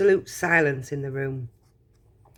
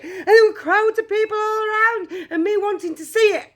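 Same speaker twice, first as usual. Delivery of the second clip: put-on very high, loud boy tumbling over his words; breathless excited yelling; 5 dB louder than usual. A narrator's reading. Charlie telling mother he found the golden ticket, excited yelling. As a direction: gabble it at a high-pitched yell like a hurricane. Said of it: "yelled Charlie, rushing in on 'em like a hurricane".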